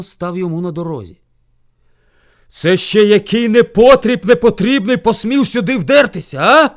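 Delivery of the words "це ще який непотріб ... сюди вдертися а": loud, high, outraged question; roaring angry bear. A narrator's reading. Bear rages at news of a rival, roaring. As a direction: roar with indignation. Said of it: "ревів він".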